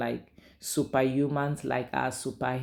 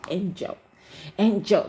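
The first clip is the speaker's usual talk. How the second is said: mock angry call of her name, rising loud; pissed husband; 7 semitones higher, 5 dB louder than usual. Husband pissed, calling wife's name sharply. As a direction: bark her name in irritation. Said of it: "that he's always doing, when he's pissed".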